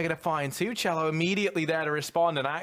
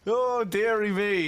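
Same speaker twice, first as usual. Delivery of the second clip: mock old dear; 8 semitones higher, 5 dB louder, slower than usual. Groaning at a bad pun.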